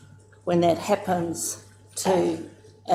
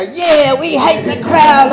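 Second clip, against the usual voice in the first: loud agreement; 8 semitones higher, 10 dB louder, slower than usual. Retold or imitated. Hapu members echo the aunties.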